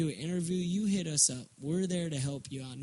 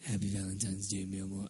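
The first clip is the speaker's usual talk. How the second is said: tender lover voice; 8 semitones lower, 6 dB quieter, faster than usual. Retold or imitated. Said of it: "He was looking at him going".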